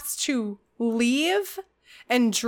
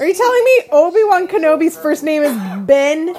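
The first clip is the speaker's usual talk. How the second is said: loud, high, laughing disbelief; incredulous; 5 semitones higher, 9 dB louder, faster than usual. Retold or imitated. Incredulous outburst at the reveal.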